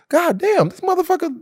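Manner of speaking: high-pitched retold disbelief; exasperated outburst. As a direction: throw your voice way up in exasperation. Exasperated quote defending Kyrie.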